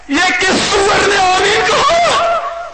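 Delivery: very high, drawn-out bellow; angry shout. Acted. Imam demands who said amen, furious acted shout.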